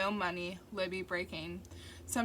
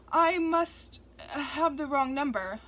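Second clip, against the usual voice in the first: flustered; 6 semitones higher, 7 dB louder, slower than usual. A narrator's reading. Narrator flustered on phone.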